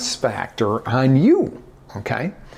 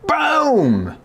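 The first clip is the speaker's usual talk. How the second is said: triumphant boom. Real, not acted. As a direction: explode with the win. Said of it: "to what it feels like to overcome it".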